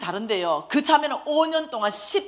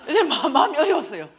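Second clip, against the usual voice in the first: ordinary delivery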